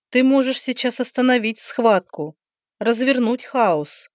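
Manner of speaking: plain reading voice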